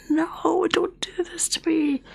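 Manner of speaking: high, breathy plea to her own voice; pleading. Retold or imitated. Begging her failing voice.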